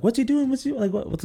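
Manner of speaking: panicked squeals of the sisters; sisters panicking. Retold or imitated. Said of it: "my sisters were like".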